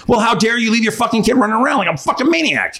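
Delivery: high-pitched, exasperated retort; acted-out outraged reply to a careless parent; indignant comeback. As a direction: throw the accusation right back, pitch climbing.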